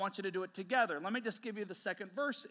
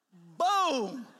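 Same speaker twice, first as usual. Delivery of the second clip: loud, punched-out boom; explosive sound-effect word; imitated impact; 8 dB louder than usual. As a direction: hit it like a sudden blow. Imitates the brother's blow landing.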